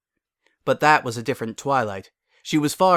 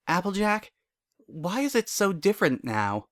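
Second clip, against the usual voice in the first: put-on higher, worried young mare; pleading gently with a friend; Twilight's voice; 8 semitones higher than usual.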